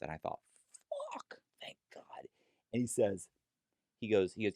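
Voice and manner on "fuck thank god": soft breathless whisper of relief; relieved whisper. Retold or imitated. Inner relief thought.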